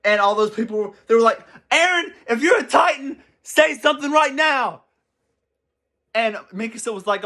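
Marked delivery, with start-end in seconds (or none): 1.7-4.8 mock shouted demand. Soldiers surrounding Eren demand he speak, mock shouted challenge. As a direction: call out to him like soldiers with cannons aimed.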